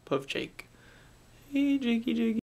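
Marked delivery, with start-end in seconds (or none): 1.5-2.4 high sweet call to the dog. Real, not acted. Owner calls his dog Jakey, high fond call. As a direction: coax the dog over fondly.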